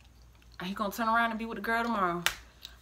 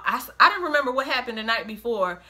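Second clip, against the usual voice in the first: usual speaking voice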